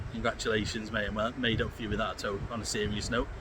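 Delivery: normal talking voice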